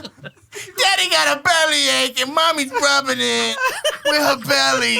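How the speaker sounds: put-on dad covering for himself to the kids, laughing; laughing excuse. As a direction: talk slowly to the kids while cracking up.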